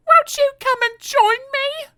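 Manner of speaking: pleading Miss Somersault. Acted. Miss Somersault invites him up.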